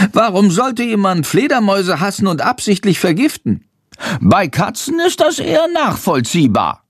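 a small bat huffing in disbelief; indignant little bat. Acted. Narrator voices the bat Chandor.

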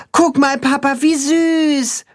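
put-on very high childlike voice; delighted boy calling. A narrator's reading. Boy calls to father about the animal, delighted. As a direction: call to dad in high-pitched delight. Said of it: "ruft der Junge seinem Vater zu".

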